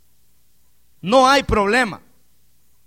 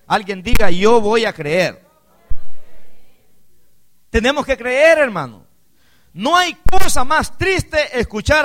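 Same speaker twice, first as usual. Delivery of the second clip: loud, slow, higher preaching push; full-force preaching; 3 semitones higher, 6 dB louder, slower than usual. Acted. Preacher exhorting the congregation to stay with Jesus, loud and slow. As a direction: hammer the promise home to the church.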